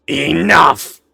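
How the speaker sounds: sudden commanding shout, pitched high; yelling. Acted. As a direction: yell it out to stop everyone in their tracks. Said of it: "and yells".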